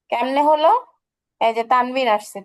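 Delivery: normal talking voice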